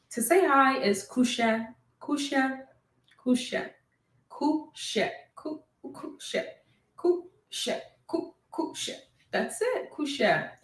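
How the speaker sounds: kushe said slowly, repeated, stress on ku; teacher repeating kushe for the class; Krio word kushe. Shown as a demonstration. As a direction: drill the word kushe, ku-she, clearly and repeatedly. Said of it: "I'm gonna be telling you how to say hello".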